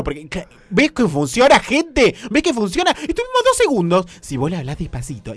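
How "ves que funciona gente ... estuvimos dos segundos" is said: higher, louder, gleeful; excited triumphant remarks. Real, not acted. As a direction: crow about it to the audience. Excited joke about seduction working.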